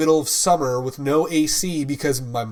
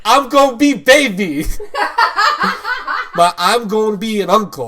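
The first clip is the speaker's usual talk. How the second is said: laughing; 7 semitones higher, 6 dB louder than usual. Real, not acted.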